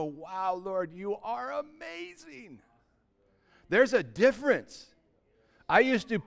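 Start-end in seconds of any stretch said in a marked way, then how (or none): 0.0-2.6 soft, slow, hushed wonder; hushed awe. Retold or imitated. Believer marvels to the Lord, softly and slowly in awe. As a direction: breathe it out in quiet worship. Worshipful quote in sermon.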